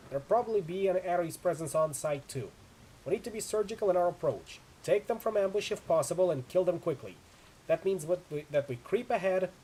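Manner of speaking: brief the team firmly over the storm; loud, commanding mission rundown; urgent briefing. Acted. Acted squad leader briefing in a storm.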